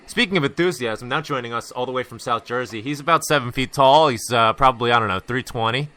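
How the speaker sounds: lightly hammed-up guest introduction, building; mock boxing-ring intro for the guest; announcer intro. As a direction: bring him on like a ring announcer.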